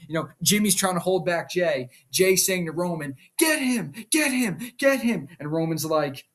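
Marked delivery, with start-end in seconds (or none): 3.4-5.3 high-pitched imitated goading; mock urging. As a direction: egg him on in an acted wrestler voice. Wrestler egging on another, acted.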